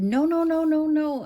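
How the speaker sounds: high, loud panicked no's. Retold or imitated. Caregiver yelling at Mom to stop dumping fruit, panicked. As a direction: shout to stop her in a panic.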